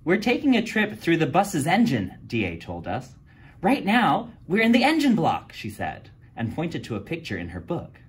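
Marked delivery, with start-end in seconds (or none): 0.0-2.1 high, eager explaining voice; girl reading from her research book; know-it-all girl. A narrator's reading. D A explains to the class. 3.6-5.5 high, eager explaining voice; girl reading from her research book; know-it-all girl. A narrator's reading. D A explains to the class.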